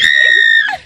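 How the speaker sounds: loud high shriek of laughter. Real, not acted. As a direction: burst out laughing at the story. Cracking up at the story.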